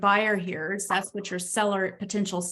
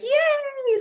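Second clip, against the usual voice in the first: very high, loud yay; cheering; 4 dB louder than usual. Retold or imitated. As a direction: squeal with joy. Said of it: "Coach Katie's like".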